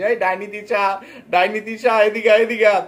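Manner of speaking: a high, loud, mocking yell across the house; mock yelling call. Vlogger mimicking the husband yelling for his wife, loud mock shout. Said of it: "গলা ফাটিয়ে চিৎকার করে করে ডাক দিয়েছিস".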